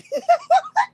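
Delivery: loud high-pitched laugh. Real, not acted. Telling on her ex to friends, loud high laugh.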